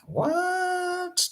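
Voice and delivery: sudden high squeak of disbelief; one stunned word, pitched very high. Shown as a demonstration. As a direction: shoot way up on What like you can't believe it. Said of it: "having disbelief".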